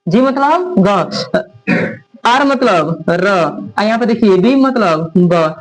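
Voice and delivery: very slow, one letter at a time; slow teaching trick. Shown as a demonstration. Tutor teaching students the GRB colour trick, very slow. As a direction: drag each pair out so students memorise it. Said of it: "trick बताते हैं।".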